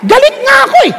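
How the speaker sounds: put-on high, childish sulk with a laugh; sulky protest. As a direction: pout and whine that you are angry. Husband refusing to say love.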